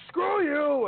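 high, loud mock shout; defiant yell back at the wife; retold yell. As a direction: shout them down.

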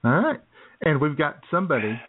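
ordinary delivery

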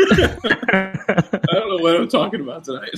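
high giggling while talking; breaking up with laughter; laughing. Real, not acted. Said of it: "laugh at another joke you made".

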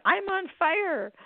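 high, drawn-out mock yell. Retelling a husband burning in the shower, drawn-out mock yell. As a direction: stretch out a high cry of pain, no real volume. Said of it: "And he yelled out".

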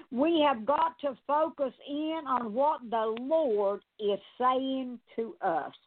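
usual speaking voice

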